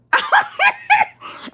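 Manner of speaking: high burst of laughter; real laugh. Real, not acted. After the plants question, laughing out loud. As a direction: crack up.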